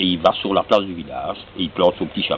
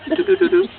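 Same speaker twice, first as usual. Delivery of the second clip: high tooting sound; 4 dB louder than usual. Pretend. Imitating the magic trick sound.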